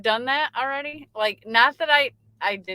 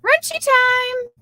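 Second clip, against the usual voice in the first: playful sing-song; 7 dB louder, slower than usual. Acted. Playful catchphrase after greeting.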